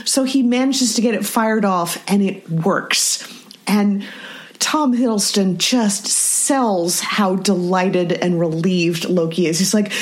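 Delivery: ordinary talk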